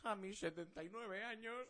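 put-on high, slow, cheery old man voice; Revilla impression. Imitating Revilla bragging on TV he feels young, high and cheery. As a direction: play a delighted seventy-nine-year-old politician. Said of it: "estaba el Revilla y dice".

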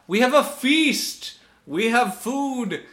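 high, slow, thrilled voice; mock excited. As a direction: gush like a starving castaway. Mock excited tribe at feast.

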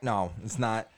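ordinary talk, nothing marked

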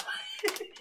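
breaks into high laughter mid-line; cracking up while talking; laughing. Real, not acted.

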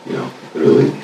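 mellow and spaced out, low-key; stoner. Real, not acted. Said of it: "I'm high every day".

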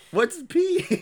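high mocking echo of the question; mocking high squeak.

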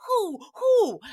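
high, loud mock owl hooting; mock owl calls. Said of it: "they're hooing at him".

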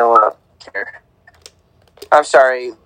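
ordinary talk

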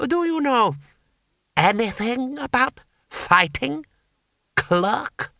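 high, slow, deliberate lordly challenge; menacing lord. A narrator's reading. Armed lord challenges a clerk to fight, menacing. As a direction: draw out the question, pitched high, to intimidate. Lord Hong taunts Two Flower.

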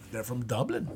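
lightly attempted, bad Irish accent; horrible Irish accent. Shown as a demonstration. Podcaster trying out Dublin for his co-host, poor Irish accent. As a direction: have a half-hearted go at an Irish accent. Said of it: "That was my horrible Irish accent".

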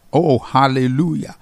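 ordinary delivery

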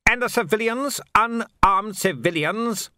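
high, dismissive officer voice; scoffing lord. A narrator's reading. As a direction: brush off the report with scorn. Venturi scoffs.

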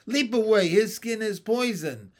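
high, loud urgent warning; shouted warning. Acted. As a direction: shout the danger to the stranger. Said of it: "I shouted to the stranger".

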